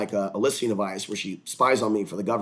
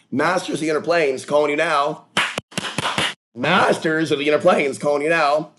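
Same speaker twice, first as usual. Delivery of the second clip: raised, ringing summoning call; spirit invocation; 4 semitones higher, 4 dB louder than usual. Pretend. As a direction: call out to the spirits, high and loud. Invoking spirits toward the viewers.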